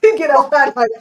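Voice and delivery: high, loud joking yell; mock shouted order. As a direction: bark it out as a playful escort order. Listener mimics officer ordering him out.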